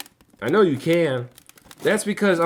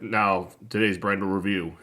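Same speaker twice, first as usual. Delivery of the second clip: bored announcer voice; 4 semitones lower than usual. Pretend. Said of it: "I'm just gonna be like".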